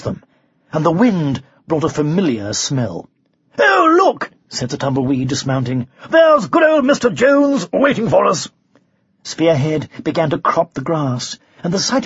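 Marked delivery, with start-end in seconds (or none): 3.6-4.3 high, loud delighted exclamation; acted happy discovery; excited knight. A narrator's reading. Knight spots his dragon friend. 6.1-8.5 high-pitched, pleased exclamation; acted cheerful enthusiasm; excited knight. A narrator's reading. Knight spots his dragon friend.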